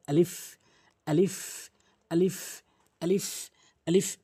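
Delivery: আলিফ repeated as a model for practice; teacher drilling আলিফ again and again; each আলিফ set apart by a pause; drilled alif. Shown as a demonstration. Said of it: "এভাবে উচ্চারণ করবেন".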